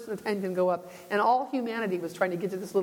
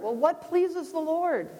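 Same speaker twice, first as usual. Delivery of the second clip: normal talking voice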